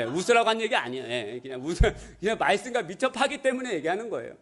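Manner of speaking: ordinary talk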